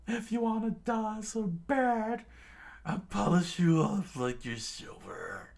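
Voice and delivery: high threatening villain voice; villain threat. Acted. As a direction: sneer the threat. Pearl threatens Sanji.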